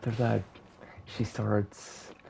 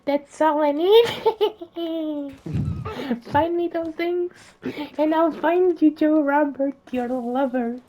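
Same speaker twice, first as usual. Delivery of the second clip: witch voice; 8 dB louder than usual. Acted. Witch lists her ingredients to Cinderella in a high cackling screech. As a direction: list the ingredients like a gleeful hag. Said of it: "the witch says".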